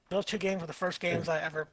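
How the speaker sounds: normal talking voice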